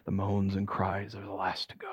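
low, very soft near-whisper; hushed storytelling as the ghost vanishes; fading hush. A narrator's reading. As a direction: let the voice die away.

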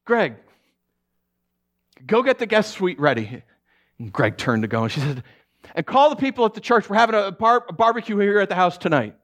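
high loud joyful orders; calling out joyfully. Retold or imitated. Mother calling to her husband, high and loud. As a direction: call out happily across the yard. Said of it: "she called out".

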